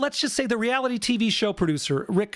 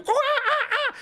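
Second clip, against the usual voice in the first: high, hooting mock monkey cries, laughing through them; monkey chatter imitation. Imitating the monkey during sex, high mock hooting. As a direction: ape a monkey hooting mid-act. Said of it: "and the monkey".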